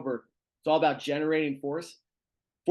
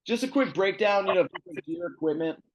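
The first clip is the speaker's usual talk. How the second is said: ordinary delivery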